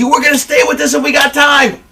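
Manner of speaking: crazed high-pitched announcer call; frantic commentator voice, held high; Jim Ross. Retold or imitated. Retelling a wrestling broadcast, going crazy on commentary. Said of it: "Jim Ross is going crazy".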